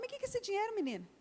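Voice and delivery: shoots up very high in astonished question; a mother startled by the money, pitch way up; surprised mother. Retold or imitated. Mother questioning daughter about money. (mãe espantada, voz muito aguda)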